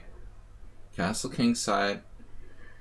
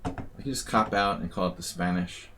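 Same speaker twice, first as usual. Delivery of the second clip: ordinary talk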